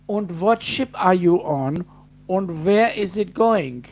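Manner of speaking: loud hammed-up German accent, high opening cry. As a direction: put on a big, booming German accent. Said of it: "trying to speak in German. Um, oh, sorry, German accent".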